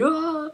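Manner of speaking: high-pitched imitation wail; play-acted high cry; mock panicked scream. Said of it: "he's going crazy".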